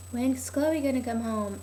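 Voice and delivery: lightly voiced waiting mother; waiting mom. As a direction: put on a mom wondering to herself.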